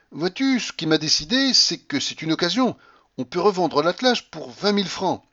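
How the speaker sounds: a hint of a playful mistress; Blanche coaxing. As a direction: tease him, sweetly. Blanche voiced by reader.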